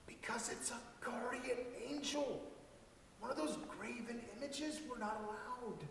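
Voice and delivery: whispered confession, barely voiced; hushed whisper. A narrator's reading. Boy whispers to his parents about the forbidden angel. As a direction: breathe it out like a guilty secret.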